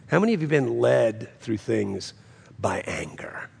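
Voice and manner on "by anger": hushed, confiding; whisper. Acted. Pastor probing the congregation's anger, whisper. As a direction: breathe it in a whisper.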